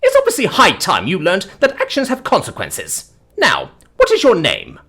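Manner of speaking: high, loud lecturing; haughty, forceful reprimand; imperious scolding dame. Acted. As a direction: demand it like an affronted matron.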